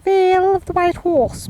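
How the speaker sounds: high, loud, plummy posh voice; posh accent. Shown as a demonstration. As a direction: say it like a posh person. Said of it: "I have to pronounce it like a posh person".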